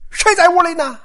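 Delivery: sudden high, loud challenge; startling shout into a dark room. Acted. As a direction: call out to catch an intruder. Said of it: "就听有人大喊一声说".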